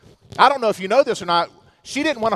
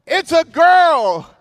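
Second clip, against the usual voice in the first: slow, drawn-out announcement, higher and louder; 6 semitones higher, 5 dB louder, much slower than usual. Retold or imitated. Doctor announcing the baby to the couple, slow drawn-out reveal. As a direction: hold every word of the news.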